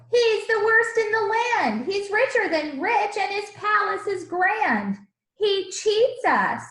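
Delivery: high, loud indignant outcry; angry shouting crowd. Acted. The crowd shouting about the cheat, loud and indignant. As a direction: rant at him, slowing on cheats us. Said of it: "they shouted".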